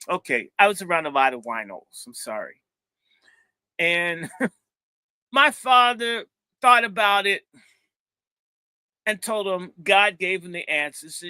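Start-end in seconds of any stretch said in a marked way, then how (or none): 3.8-7.4 laughing through the words, louder. Real, not acted. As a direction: chuckle while telling it.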